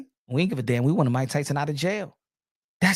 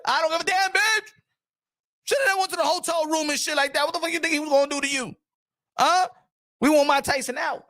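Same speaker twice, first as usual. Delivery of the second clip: mock fan rant; 11 semitones higher than usual. Voicing toxic fans' rant.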